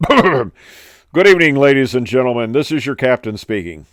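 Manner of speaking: hammed-up British PA voice; British captain accent. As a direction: announce it like a British airline captain, opening big. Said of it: "my best, uh, British, uh, accent".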